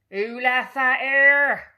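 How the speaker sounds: furious captain stepping in a bucket; slow booming pirate demand; growling captain. A narrator's reading. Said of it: "growled the captain".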